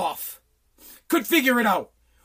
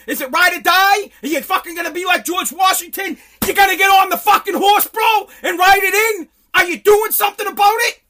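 loud, high furious yelling; angry shouting rant; 7 semitones higher, 6 dB louder than usual. Real, not acted. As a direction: yell it at the president, fists up. Ranting at president, escalating yells.

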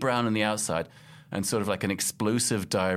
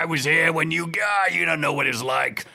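lightly voiced geezer, louder and higher; a hint of a grumpy old-timer lecturing; mock old man lecturing young people; old guy; 8 semitones higher, 5 dB louder than usual. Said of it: "such an old guy".

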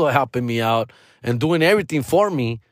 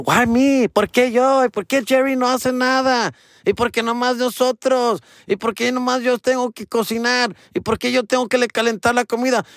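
high, whining sister voice; whiny sister; 11 semitones higher than usual. Retold or imitated. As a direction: complain like a fed-up sister. Sisters complaining about chores, imitated.

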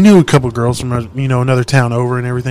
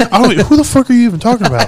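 exasperated retort; 10 semitones higher, 5 dB louder, faster than usual. Retold or imitated.

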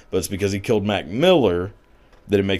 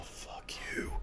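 breathy whisper, barely voiced, much softer; whispered insult; 20 dB quieter than usual. A sneer at party people, whispered under the breath. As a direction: spit it out under your breath.

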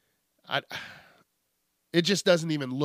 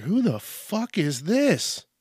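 slow, baffled question, pitched higher; confused; 6 semitones higher, slower than usual. Retold or imitated. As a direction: drag it out in disbelief. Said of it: "And I'm like".

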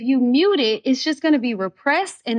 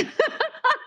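a short, much higher laugh through tears; teary laugh. Real, not acted. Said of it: "getting teared up".

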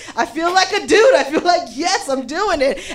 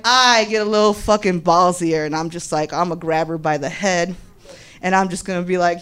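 ordinary delivery

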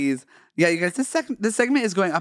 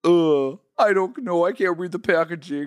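[name]. Acted. Cookie seller puzzling over packaging, loose [name] impression. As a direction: give it a loose diva impression. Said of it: "we're gonna retire Miss [name]".

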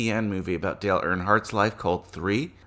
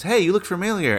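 a character's voice, pitched much higher; high mock character voice; 10 semitones higher than usual. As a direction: toss it off high and bright like a movie extra. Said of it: "one of the characters says".

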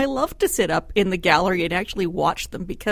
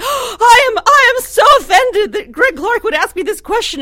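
high, loud hammed-up outrage with a whimper; mock offended politician; 14 semitones higher, 10 dB louder than usual. Columnist mocking Notley in the legislature, hammed-up offence. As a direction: clutch your pearls and wail it.

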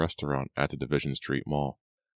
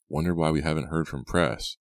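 usual speaking voice

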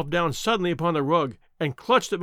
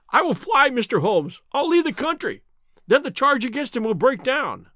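high, urgent bargaining; desperate pleading; 6 semitones higher, 3 dB louder than usual. A narrator's reading. Ryder promises Holmes to flee the country, desperately. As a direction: offer anything to escape, in a panic.

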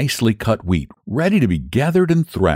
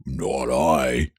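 much lower, softer piggy refusal; lazy pig; 9 semitones lower, 5 dB quieter than usual. Acted. Pig refusing the hen, low soft grumble. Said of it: "But the pig said".

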